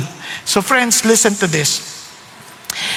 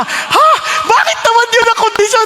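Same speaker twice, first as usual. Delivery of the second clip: protesting; 13 semitones higher than usual. Retold or imitated. Protesting the Lord's condition, high and incredulous. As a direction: blurt out in disbelief.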